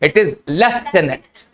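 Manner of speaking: slow careful reading. Shown as a demonstration. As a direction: read lieutenant very slowly. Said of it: "क्या लिखा हुआ है?". (धीरे धीरे साफ़ उच्चारण)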